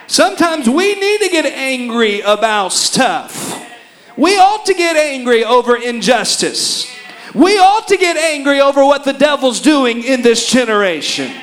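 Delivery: loud, driving sermon exhortation; full-force preaching. Acted. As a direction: hammer the call to anger.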